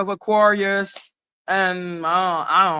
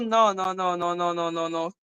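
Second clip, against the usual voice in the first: softer and softer no; fading no; 3 semitones lower, 6 dB quieter than usual. Real, not acted. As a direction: trail off into nothing. Fading repeated no.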